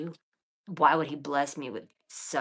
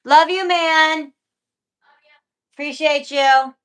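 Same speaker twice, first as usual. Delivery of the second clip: much higher and louder, slow and gushing; loud excited thanks; 11 semitones higher, 15 dB louder, slower than usual. Real, not acted. Thanking a viewer, loud and gushing. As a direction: shout your love to the viewer.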